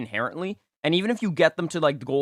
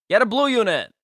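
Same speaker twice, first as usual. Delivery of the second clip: emphatic call; 7 semitones higher, 5 dB louder than usual. Real, not acted. Urging the team owner.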